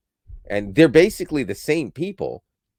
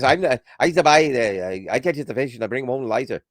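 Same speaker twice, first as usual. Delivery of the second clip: louder, quick Newfoundland dialect; Newfoundland accent; 2 semitones higher, 3 dB louder than usual. Retold or imitated. Showing how Newfoundlanders talk, in thick local accent. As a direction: rattle it off like a Newfoundlander. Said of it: "talk like this".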